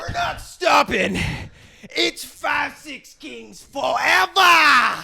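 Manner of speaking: high, loud, slow rallying yell; defiant shout. Acted. Cohost revealing his tattoo, slow defiant shout. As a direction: bellow it like a battle cry.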